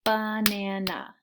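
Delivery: slow ba-na-na in three beats; clapped syllables. Shown as a demonstration. Teacher sounding out banana slowly for kids. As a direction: draw Banana out in three claps. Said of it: "one more time".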